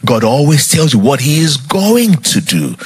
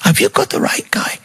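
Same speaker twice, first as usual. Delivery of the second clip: high, hushed incredulous question; disbelieving, squeaky-soft aside; incredulous thought; 3 dB quieter than usual. Acted. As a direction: doubt it in a small high half-whisper. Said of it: "by now you're thinking".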